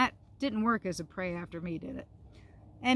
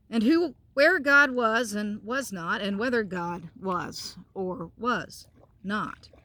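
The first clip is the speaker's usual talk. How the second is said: gentle storybook narration; reading to children; 5 dB louder than usual. A narrator's reading. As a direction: read it out like a bedtime story. Continues reading the book.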